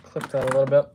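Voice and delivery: talking with hiccups; hiccuping between words. Real, not acted. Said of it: "I think I got the hiccups now".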